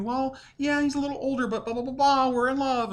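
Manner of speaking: very high, put-on lovey wife voice; hammed-up high wifely cooing; pretend young wife. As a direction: gush jokingly as the pretend wife. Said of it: "just joking".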